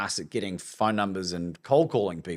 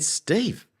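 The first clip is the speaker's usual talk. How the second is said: stunned; 11 semitones higher than usual. Retold or imitated. Retold stunned reply.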